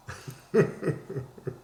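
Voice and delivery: high-pitched run of giggles; real laugh. Real, not acted. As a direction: crack up at your own joke. Laughs at own joke.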